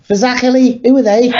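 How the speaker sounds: Scouse accent, high and cartoonish; Scouse advert voice. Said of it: "it reminds me of that advert from the nineties".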